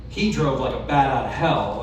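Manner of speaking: ordinary delivery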